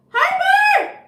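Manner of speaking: high excited shout of a name. Real, not acted. Streamer greeting a viewer by name, high excited shout. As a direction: yell the name out in delight.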